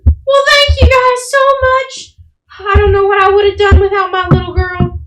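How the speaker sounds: very high, loud matronly voice; high-pitched, sing-song effusive woman; grateful mother. Acted. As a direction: gush thanks like a relieved mom. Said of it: "she looks and goes".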